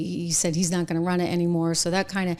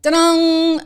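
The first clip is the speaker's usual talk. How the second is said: loud, high gotcha cry; spring the trap with a shout; triumphant ta-dang; 10 semitones higher, 7 dB louder than usual. Retold or imitated. Trump springing a libel suit, loud and triumphant. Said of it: "he's like".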